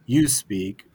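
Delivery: normal talking voice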